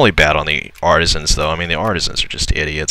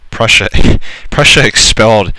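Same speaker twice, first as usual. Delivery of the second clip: surprised outburst; 4 semitones higher, 6 dB louder than usual. Real, not acted. Shocked outburst at game news.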